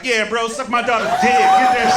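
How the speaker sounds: loud, high, hyped-up shout; acted hype, shouting along with the guys; joining the hollering. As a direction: jump in yelling like one of the boys. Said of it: "I jumped in with him".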